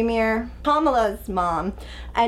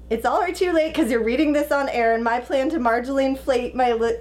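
ordinary talk, nothing marked

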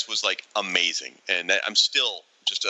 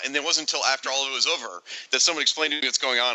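ordinary talk

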